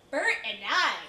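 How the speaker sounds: loud, thrilled outburst; swooning over a dream partner, loudly; excited exclamation. Acted. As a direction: belt it out with delight. Exclaims dream partner's name, third time.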